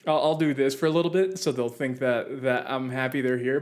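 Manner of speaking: normal talking voice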